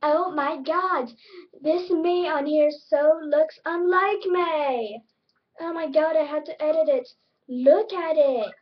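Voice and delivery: loud, high, drawn-out mock outrage; annoying opposite self. Opposite self showing off the hated look, loud mock disgust. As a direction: play an over-dramatic, annoying version of yourself. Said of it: "how annoying my opposite self was".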